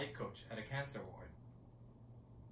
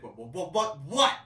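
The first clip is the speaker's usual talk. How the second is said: irritated what what; 11 dB louder, slower than usual. Retold or imitated. Annoyed guy whirling round on a taunting roommate, rising irritation. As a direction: snap back with mounting irritation.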